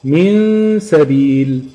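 "من سبيل" very slow, with a long nasal hum before the seen; ghunna, then letter. Shown as a demonstration. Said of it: "بعد ما بخلص الغنة بجيب الحرف".

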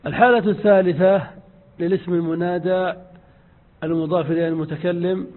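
ordinary delivery

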